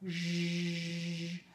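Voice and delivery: "J" held out low and voiced; sustained "J" with the finger on the cheek; demo j sound. Shown as a demonstration. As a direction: buzz a long "J" between closed teeth. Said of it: "l'index sur la joue".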